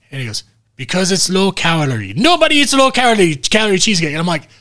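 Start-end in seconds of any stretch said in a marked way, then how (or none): 0.8-4.1 high, loud hammed-up exclamation; mock outburst. Film doctor explaining the cheesecake ban, mock outburst.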